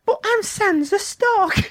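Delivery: very high, slow mock girl voice, Cockney; high-pitched Cockney character parody; Sansa, Cockney. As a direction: squeak it slowly in a Cockney accent. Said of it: "Still a Cockney".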